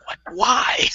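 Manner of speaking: high-pitched mock-distressed why; exasperated disbelief. Coming back to fifteen new messages, exasperated disbelief. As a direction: squeak out a baffled protest.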